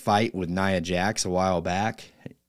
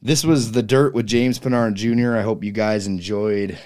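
show outro announcer read; 3 semitones higher, 7 dB louder than usual. Acted. Podcast segment outro.

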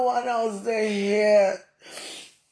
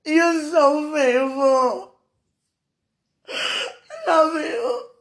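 slow, crying words of love, higher voice; tearful devotion; 5 semitones higher, much slower than usual. Real, not acted. Speaker praises God's faithfulness, tearfully. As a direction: draw it out through real tears.

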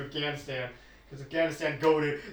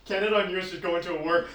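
ordinary delivery

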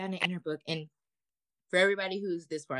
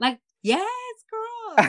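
much higher, louder hype cheer; 10 dB louder, slower than usual. Real, not acted. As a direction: hype her up like a proud friend. Cheering the rebellious kid.

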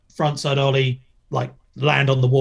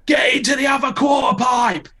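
retold angry shout; 12 semitones higher than usual. Coach figure yelling at the skater, furious shout. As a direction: bark it across the ramps. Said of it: "He's like".

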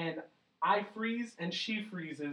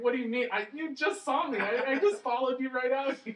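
high, loud retold disbelief; incredulous protest; 8 semitones higher, 6 dB louder than usual. Storyteller acting out his reply to girlfriend, incredulous. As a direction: protest louder and higher, baffled by the question.

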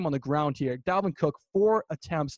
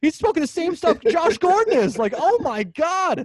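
loud, high, incredulous outburst; driven crazy; 10 semitones higher, 5 dB louder than usual. Real, not acted. Host mocks his friend's absurd opinion, exasperated outburst. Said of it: "it's really been driving me crazy".

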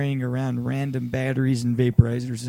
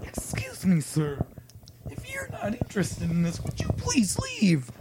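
put-on prissy scolding girl; 7 semitones higher than usual. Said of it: "Some girl turns around and is like".

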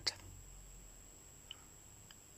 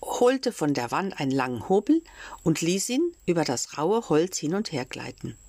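usual speaking voice

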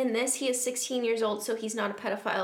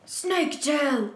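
high, slow theatrical villain call; Voldemort calling out; 5 semitones higher, 4 dB louder, much slower than usual. Acted. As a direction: call out grandly, stretching the words. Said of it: "called Voldemort".

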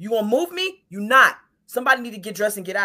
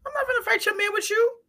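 far higher, rising refusal; much higher, incredulous no; 14 semitones higher than usual. Retold or imitated. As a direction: squeal the refusal in disbelief. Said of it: "stop being the friend that's like".

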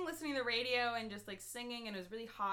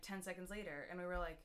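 normal talking voice

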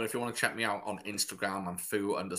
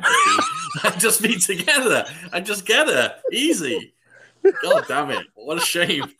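high, loud, laughing mock triumph; joking outburst; 11 semitones higher, 10 dB louder than usual. Joking that he'd get her with one line, loud mock triumph. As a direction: shout it through laughter, hamming up the regret.

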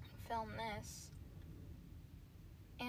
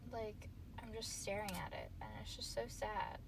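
ordinary talk, nothing marked

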